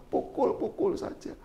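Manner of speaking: "pukul-pukul saja" breathed slow and soft, almost whispered; whispered taunt. Retold or imitated. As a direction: hiss the dare under your breath. Istri menantang, dibisikkan. ("pukul-pukul saja" dibisikkan pelan)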